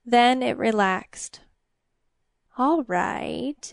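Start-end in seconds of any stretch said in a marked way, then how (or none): 2.6-3.7 drawn-out, insinuating creature voice; sly demon. A narrator's reading. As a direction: concede with sly relish. Demon relents slyly.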